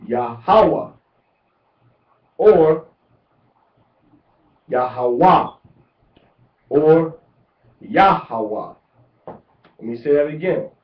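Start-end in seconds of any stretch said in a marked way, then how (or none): 0.1-0.9 "Yahweh" said ya-HA-wa, middle syllable punched; stress on second syllable. Shown as a demonstration. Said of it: "with the accent on the second syllable". 4.7-5.5 "Yahweh" said ya-ha-WA, last syllable punched; stress on third syllable. Shown as a demonstration. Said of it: "pronounce it on the third syllable". 7.8-8.7 "Yahweh" said YA-ha-wa, first syllable punched; stress on first syllable. Shown as a demonstration. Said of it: "the first syllable".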